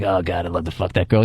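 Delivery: low evil pirate aside, lightly hammed; evil pirate leer. Guys leaning in to check out girls, evil pirate undertone. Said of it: "do that evil pirate shit".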